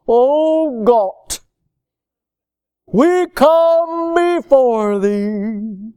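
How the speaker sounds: very slow, stretched-out pious intoning; mock prayer voice. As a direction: parody a showy prayer, dragging out the vowels. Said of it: "they'll stretch their voice out".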